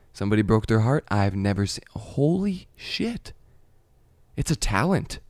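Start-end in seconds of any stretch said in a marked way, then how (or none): 1.9-3.3 slow, breath-only amazement; awed whisper. Acted. Recalling what sweet girls did, stunned whisper.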